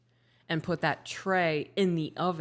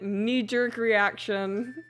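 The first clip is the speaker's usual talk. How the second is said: ordinary delivery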